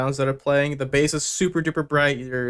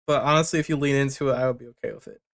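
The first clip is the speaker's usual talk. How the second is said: normal talking voice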